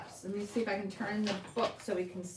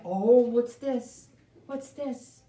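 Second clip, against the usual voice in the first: mock surprise; 6 dB louder, slower than usual. Teacher feigning discovery in book.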